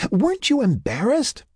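high, giggly female character voice; laughing question. A narrator's reading. Sarah laughing with abandon at Mac's story, laughing question. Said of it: "Sarah laughed with abandon".

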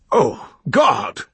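high, loud wail of dismay; anguished cry. A narrator's reading. Reverend protesting the accusations before his flock, anguished cry. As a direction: cry out as if horrified. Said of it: "cried the Reverend".

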